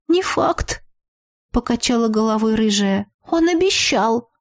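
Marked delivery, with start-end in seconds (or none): 0.1-0.8 put-on high-pitched girl's doubt; doubtful friend. A narrator's reading. Doubting friend answers in character voice. 3.3-4.3 put-on high-pitched girl's protest; worried friend. A narrator's reading. Same friend insists, character voice.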